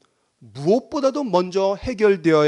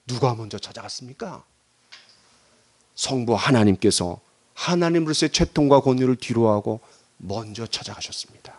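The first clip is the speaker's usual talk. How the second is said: normal talking voice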